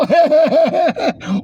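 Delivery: hearty mocking laugh; loud laugh. Real, not acted.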